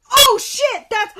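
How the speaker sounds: loud, high shocked outburst; real shock. Real, not acted. Shocked reaction to the meme.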